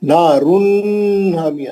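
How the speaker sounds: नारुन हामिया with a drawn-out humming n; careless held nun. Shown as a demonstration. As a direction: stretch the n of नारुन straight into हामिया. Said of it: "हम लोग बेख्याली में पढ़ते हैं".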